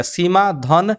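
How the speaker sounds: सीमा धन अंत, each part slow and separate; slow classroom dictation of सीमा धन अंत; slow spelled-out dictation. Shown as a demonstration. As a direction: read it slowly with धन, not plus. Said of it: "इसको धन पढ़िएगा".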